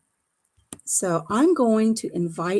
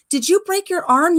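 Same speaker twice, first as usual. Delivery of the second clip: imitated alarm, high and loud; alarmed question; 10 semitones higher, 7 dB louder than usual. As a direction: play the shocked shop assistant, pitched right up. Retells lady's alarmed question.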